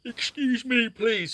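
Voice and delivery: words stretched through a yawn. Real, not acted. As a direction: apologise mid-yawn, mouth wide open. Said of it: "Sorry for yawning".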